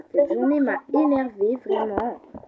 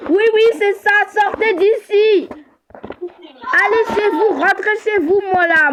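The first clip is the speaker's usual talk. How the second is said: angry order; 5 semitones higher than usual. Acted. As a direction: order him out, stretching each word. Ordering the intruder out.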